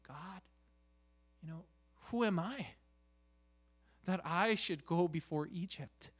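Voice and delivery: ordinary talk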